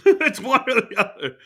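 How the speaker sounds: can't stop laughing; real laughter breaking into the words. Real, not acted. Both cracking up.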